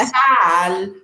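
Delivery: MUSICAL stretched high on its last syllable; exaggerated stress. Shown as a demonstration. Coach demonstrating word music to interviewer, exaggerated stress. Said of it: "la estoy exagerando".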